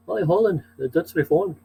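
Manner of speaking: badly faked Dutch accent. Shown as a demonstration. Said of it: "Can't do a Holland accent".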